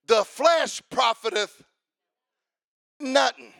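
climbing to a shouted testimony; fiery testimony over music; preaching at full force. Real, not acted. Preached scripture climax.

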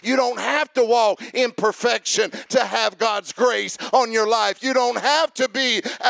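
rising to a full preaching cry. Acted. Preacher to congregation, building to a fervent shout.

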